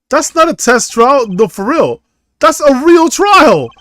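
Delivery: high, loud disbelief, stretched words; slow, emphatic outcry of disbelief; alarmed protest. Acted. Alarmed reply to game character.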